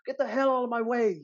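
high-pitched, hammed-up British yell; bad British accent. British actress yelling at extras in her way, mock British accent. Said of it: "in a British accent, though".

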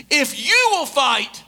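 "you" stretched high and loud; slow preacher's emphasis on "you"; stress on YOU. Real, not acted. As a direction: lean long and hard on "you", slowly. Sermon point, stressed and drawn out.

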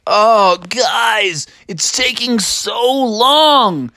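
put-on high, drawn-out kid complaint; whining Froggy.

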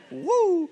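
high-pitched drawn-out "woo"; excited whoop. Retold or imitated. Wally jumping off the blob, retold with an excited whoop. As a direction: let out a high playful whoop.

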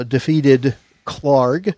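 drawn out, very slow; slow dramatic buildup. Pretend. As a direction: stretch it for suspense. Dramatic buildup to boss name.

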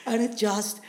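excited laughing. Real, not acted.

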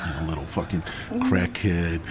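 normal talking voice

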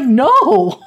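exasperated character's denial; emphatic denial. Retold or imitated. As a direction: blurt out a big retold "no". Exasperated denial in retold dialogue.

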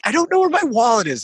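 telling his buddy his wallet is gone, high frantic whine. Retold or imitated. Worried, pitch way up. Retold panic on the phone.

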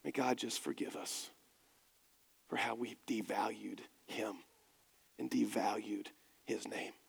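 put-on an old elder whispering a prayer for forgiveness; whispered prayer. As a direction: breathe it out like a grieving prayer. Elder's parting prayer, hushed.

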